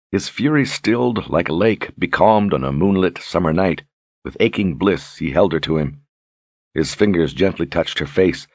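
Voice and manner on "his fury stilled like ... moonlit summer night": ordinary delivery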